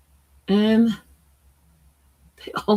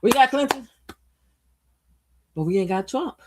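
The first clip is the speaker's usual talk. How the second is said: mock gloating; 2 semitones higher, 4 dB louder than usual. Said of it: "it's like".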